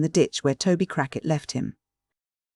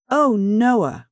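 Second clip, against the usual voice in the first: higher, loud reproach; reproachful; 7 semitones higher, 4 dB louder than usual. A narrator's reading. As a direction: cry out wounded at Noah. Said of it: "cried Charlotte reproachfully".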